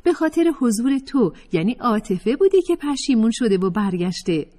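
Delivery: a hint of a gossiping voice; Ms Otufat quoted. Fatemeh relaying Ms Otufat, lightly voiced.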